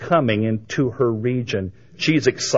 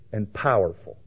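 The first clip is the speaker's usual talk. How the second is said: ordinary talk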